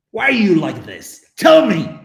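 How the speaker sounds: loud outburst, rising to a high yell; mock exasperated shout. Streamer berating indifferent viewers in jest, exasperated shout. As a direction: demand an answer in playful despair.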